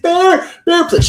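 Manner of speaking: put-on high, loud pleading girlfriend voice; psycho girlfriend pleading. Nikki pounding on the bathroom door begging Bear, high unhinged plea. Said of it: "psycho girlfriend".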